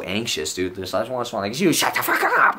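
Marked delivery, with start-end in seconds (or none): 1.6-2.6 high mock yell, half laughing; hammed-up, high-pitched snap; exasperated outburst. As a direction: blurt it out as a comic inner scream. Imagined outburst at the talkative roommate.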